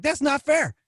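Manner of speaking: high, whiny protest; mock whine. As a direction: play the hard-done-by kid.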